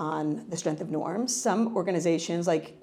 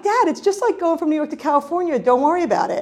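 breezy reassurance; 9 semitones higher, 5 dB louder than usual. Retold or imitated. Reassuring dad, retelling her reply.

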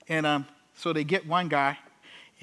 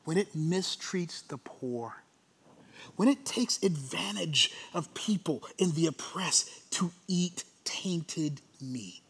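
hushed preaching; 5 dB quieter, slower than usual. Real, not acted. Preacher warning of mistreating the poor, hushed. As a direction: draw the last words out softly, with pauses. Hushed, solemn sermon point.

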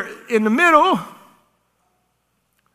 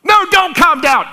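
much higher and louder, gleeful; excited burst; 11 semitones higher, 6 dB louder than usual. Real, not acted. Preacher telling herself to stay fired up, excited shout. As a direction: overrule yourself with a joyful shout. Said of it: "I get excited about it".

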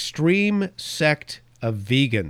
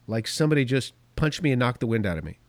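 ordinary talk

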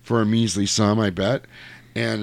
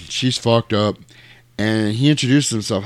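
ordinary talk, nothing marked

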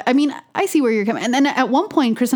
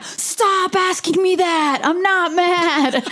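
high, drawn-out exasperated protest; mad; 7 semitones higher, much slower than usual. Retold or imitated. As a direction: stretch every word in mock-angry exasperation. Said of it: "And then I get mad".

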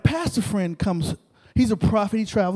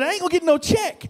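high-pitched imitated objection; mock protest; 8 semitones higher than usual. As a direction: mimic a friend complaining in a raised pitch. Friend protests about his pay.

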